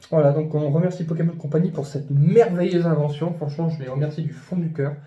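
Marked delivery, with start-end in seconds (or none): none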